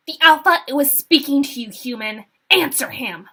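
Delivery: loud commanding yell. A narrator's reading. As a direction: shout the order at her. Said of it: "a female voice yelled".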